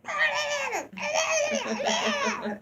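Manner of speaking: very high wordless squealing, breaking into laughter; mock shrieking match. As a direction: imitate two people escalating into loud shrieks. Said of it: "rise to their level and it's like".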